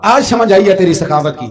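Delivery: a loud, overwhelmed cry of praise. Retold or imitated. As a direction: cry it out in awe. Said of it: "میں نے کہا". (بلند آواز میں تحسین)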